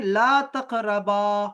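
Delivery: teacher recites the verse slowly; slow loud recitation. Shown as a demonstration. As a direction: stretch ला तक़रबा slowly for the class. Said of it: "कह रहे हैं".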